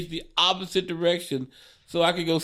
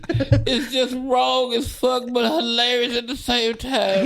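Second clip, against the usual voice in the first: high, laughing disbelief; 7 semitones higher, 3 dB louder than usual. Real, not acted. Comic cracking up retelling the drunk friend's stunt. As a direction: laugh through it, high and delighted. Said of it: "laughing while describing the act".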